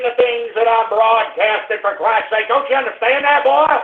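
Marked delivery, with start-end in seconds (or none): none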